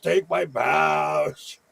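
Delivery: long, drawn-out mock cricket wail; mock cricket scream. Joking about what crickets now say, mock screaming. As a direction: stretch the words out like a pleading cricket. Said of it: "So all that screaming".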